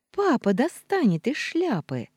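put-on bright, eager child cry; excited girl. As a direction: clap and blurt it out. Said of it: "хлопнула она в ладоши".

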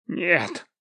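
wheezed. A narrator's reading. Pinned bandit refuses to say who he is, lightly wheezed. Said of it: "просипел бандит".

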